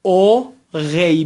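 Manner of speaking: او غی slowly split, ending in a y glide; French او غی with throaty r, drawn out; syllable-by-syllable demo. Shown as a demonstration. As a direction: sound out او غی in two parts.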